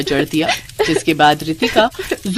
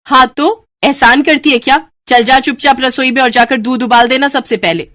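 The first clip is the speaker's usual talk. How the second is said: loud, higher harsh command; angry order; 6 semitones higher, 5 dB louder than usual. Acted. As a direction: bark the order at her. Bossing the girl angrily. (गुस्से से हुक्म देते हुए)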